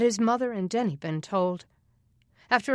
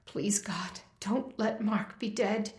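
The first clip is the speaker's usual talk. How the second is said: soft, slow pleading under the breath; desperate prayer; 5 dB quieter, slower than usual. Acted. Denny prays softly for Mark while pedaling, desperate. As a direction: pray quietly in desperation.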